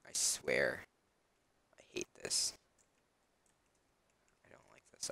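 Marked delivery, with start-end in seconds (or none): 1.8-5.1 low, whispered grumble; annoyed whisper. Real, not acted. Annoyed at jump boost.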